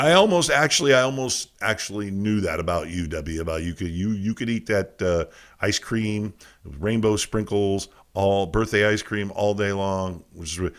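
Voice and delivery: usual speaking voice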